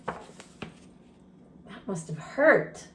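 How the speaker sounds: slow, soft sympathy; gentle aside; sympathetic. Real, not acted. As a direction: feel his pain.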